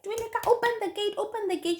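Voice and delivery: high, rushed acted plea; re-enacted panic at the gate; urgent panicked call. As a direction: beg to be let in, fast and high. Fleeing, begs friend to open gate.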